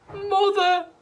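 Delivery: high, loud wail. A narrator's reading. Missus Cranky wailing at her mother, high and loud. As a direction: wail it out in dismay. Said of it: "wailed Missus Cranky".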